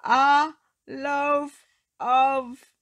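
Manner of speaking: yelling for bread; top-of-voice shout. Acted. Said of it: "shouting at the very top of his voice".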